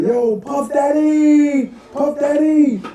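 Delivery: high, drawn-out fan yelling a name; excited shout. Retold or imitated. Kid fan spotting [name] on the street, shouting his name. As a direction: hail a celebrity across the street.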